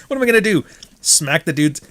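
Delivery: ordinary talk, nothing marked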